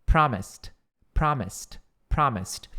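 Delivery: Promised ending in a crisp t. Shown as a demonstration. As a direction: finish Promised on a t sound. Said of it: "o som vai ficar T".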